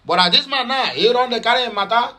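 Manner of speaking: usual speaking voice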